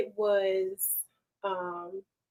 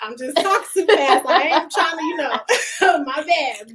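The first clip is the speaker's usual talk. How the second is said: laughing apology; 7 semitones higher, 10 dB louder than usual. Real, not acted.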